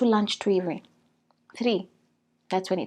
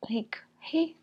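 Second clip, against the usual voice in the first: whisper; 5 dB quieter than usual. Real, not acted. Whispered aside.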